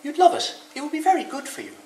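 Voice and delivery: very high, loud encouraging call; shouting down. A narrator's reading. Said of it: "she shouts down to Rabbit".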